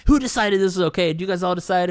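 high, loud mock interrogation; pissed off. Painter confronting the studio mates over the decision, pissed off. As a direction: imitate an indignant confrontation. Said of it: "got all pissed off".

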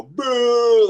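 high, loud playful boo; shouted boo. Retold or imitated. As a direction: jump at them with a boo. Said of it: "run up and go".

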